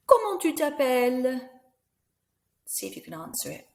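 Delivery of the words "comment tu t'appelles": put-on squeaky child puppet asking a name; high puppet voice. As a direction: play the puppet, high and bright.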